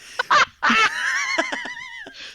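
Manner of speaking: very high, drawn-out pterodactyl shriek; long piercing mock-dinosaur cry; pterodactyl screech. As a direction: screech like a pterodactyl, far above your voice. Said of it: "do my new pterodactyl bit".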